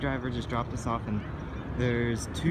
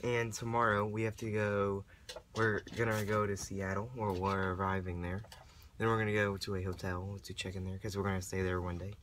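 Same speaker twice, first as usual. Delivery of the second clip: raspy whisper; 3 semitones lower, 8 dB quieter than usual. Real, not acted. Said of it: "Excuse my voice".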